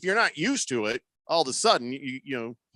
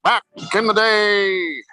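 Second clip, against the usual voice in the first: slow, louder announcer opening; 6 semitones higher, 8 dB louder, slower than usual. Acted. Host opening history segment to listeners, slow announcer read. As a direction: open it like a grand sports show.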